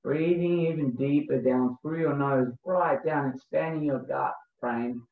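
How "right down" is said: ordinary talk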